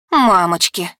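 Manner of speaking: acted groan, falling in pitch; mild dismayed wail from behind; moan of dismay. A narrator's reading. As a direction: moan it out, appalled. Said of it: "услышала я стон Фионы".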